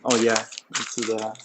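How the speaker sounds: ordinary talk, nothing marked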